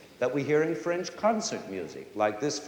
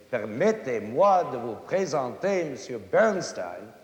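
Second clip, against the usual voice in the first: foreigner's French with heavy uneven stresses; non-French accent; 4 dB louder than usual. Acted. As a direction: mangle the French with punched, bouncing accents. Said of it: "he's no Frenchman".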